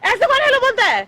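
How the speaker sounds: high, loud scolding yell; angry shout. Real, not acted. Woman scolds man over the way he said hello, shouting. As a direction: shout him down, incredulous. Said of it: "चिल्ला क्यों रही हो?".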